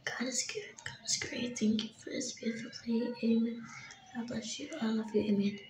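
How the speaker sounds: soft, breathy whisper of a prayer at the table. Acted. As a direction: whisper a quick grace before dinner.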